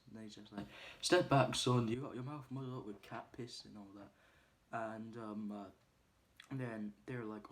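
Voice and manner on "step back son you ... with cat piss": louder, sharp put-down to a lout; mock tough put-down. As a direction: warn him off like a hard man. Retelling coach's put-down.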